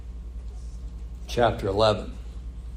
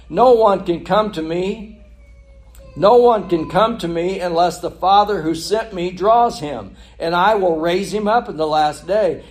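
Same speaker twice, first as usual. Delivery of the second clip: raised, loud, declamatory Bible reading; forceful scripture reading; 7 semitones higher, 9 dB louder than usual. A narrator's reading. Preacher reading how Jesus answered the grumblers, forceful proclamation. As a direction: proclaim the verses to the whole congregation.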